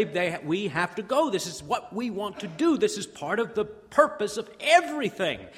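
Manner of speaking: high, insistent enthusiasm; eager excitement. Acted. Believers declaring they want to go to worship, excited. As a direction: declare it with loud, keen desire. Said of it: "They'll say it with excitement".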